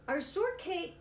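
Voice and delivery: high, loud cry of dismay. Acted. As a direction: shriek at the loss. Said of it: "cried Iguana".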